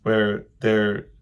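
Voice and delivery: normal talking voice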